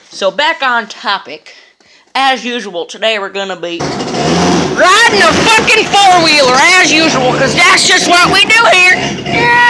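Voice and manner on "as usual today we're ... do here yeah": building from loud to a full high shout; hyped yell. Acted. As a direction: ramp it up into a cheering yell. Hyped four-wheeler intro, yelling.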